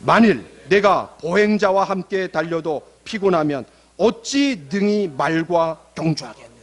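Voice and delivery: slow, measured verse reading; slow congregational recitation; reading scripture aloud. Shown as a demonstration. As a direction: read it out deliberately, phrase by phrase, for all to follow. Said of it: "다시 한번 읽어봅시다".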